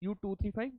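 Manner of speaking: dictating. Shown as a demonstration. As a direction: say it again calmly for writing. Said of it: "let me dictate now".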